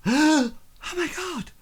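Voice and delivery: very high shocked outcry; shocked onlooker finding the dead lord, high horrified; shocked gasp. Acted. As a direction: shriek in horror at the death. NPC discovers dead lord.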